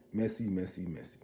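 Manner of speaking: softening to a whisper of messy; gleeful hushed tsk. Real, not acted. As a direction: fade it out like juicy gossip. Gossipy aside on the drama.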